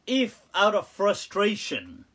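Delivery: ordinary delivery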